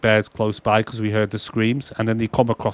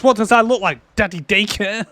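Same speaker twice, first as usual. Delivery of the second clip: mock outrage; 10 semitones higher than usual. Sid mocking Eddie about his son, high sarcastic squeak. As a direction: fire back the sarcasm in a squeaky pitch. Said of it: "And he goes".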